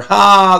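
loud, high single burst of amusement; delighted "ha". Real, not acted. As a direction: let out one big "Ha!". Delighted at a clever answer.

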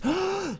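sharp surprised gasp; a quick startled breath in. Real, not acted. Sees gorillas.

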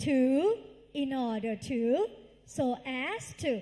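loud rhythmic drill, phrase by phrase; loud group recitation. Shown as a demonstration. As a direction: lead the class in chanting it loudly. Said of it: "ดังๆ ค่ะลูก".

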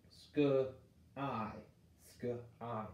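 "Sk-I" stretched slowly, sound by sound. Shown as a demonstration. Teacher testing the Y as long I, slow sounding out. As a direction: draw out each sound. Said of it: "I'm gonna try that I sound".

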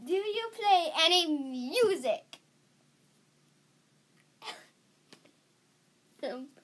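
ordinary delivery

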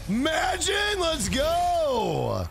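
high, drawn-out celebratory yell; excited shout. Real, not acted. Streamer celebrating with the team in game, excited shout. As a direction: shout it out in real triumph, pitch way up.

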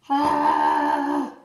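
gagging gasp; dry heaving. Real, not acted. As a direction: choke and gag on the powder. Choking on cinnamon.

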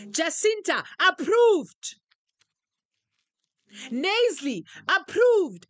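loud, drawn-out preacher's proclamation naming each person; preaching declaration. Acted. Preacher declaring members approved, full-force preaching. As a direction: proclaim each name like a blessing from the pulpit.

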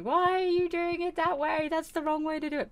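mock-call up from below; lightly voiced heckler; imitated shouting critic. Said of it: "someone's shouting to me".

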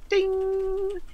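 high sung elevator ding; elevator ding imitation. Narrator marking the elevator arriving, a high sung ding. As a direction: chime like an elevator bell.

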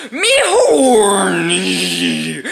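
slow drawn-out brutish Hulk moan. Acted. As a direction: drag each word like a dumb brute. Now speaking as the Hulk.

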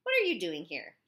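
loud, sharp bark of a question. A narrator's reading. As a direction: snap it out loudly at the intruder. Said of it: "while Mimi Mitchum barked".

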